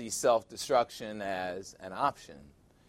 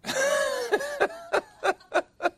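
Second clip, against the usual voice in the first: real laugh. Real, not acted. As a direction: crack up laughing. Laughs at own joke.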